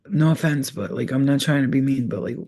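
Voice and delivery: ordinary talk, nothing marked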